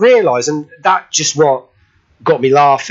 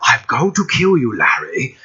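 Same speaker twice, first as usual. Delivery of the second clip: put-on menacing old horror-film villain, dropping low on the name; Boris Karloff; 7 semitones lower, 3 dB quieter than usual. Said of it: "I do a Boris Karloff impression".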